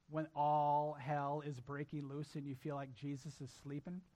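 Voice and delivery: ordinary talk, nothing marked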